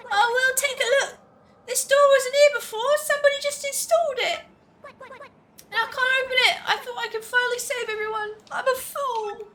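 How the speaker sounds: high-pitched, dismayed game hero; high, melodramatic character voice; earnest hero character. Acted. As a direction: pipe up high, then sink into self-reproach.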